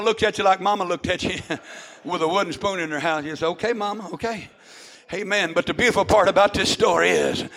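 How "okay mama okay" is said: meek okay. Retold or imitated. Meek answer to mama with spoon.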